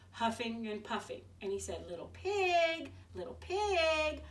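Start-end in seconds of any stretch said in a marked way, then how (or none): 1.9-4.2 high, slow sly wolf call. Acted. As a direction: draw the pig out slowly. Wolf calling at pig's door.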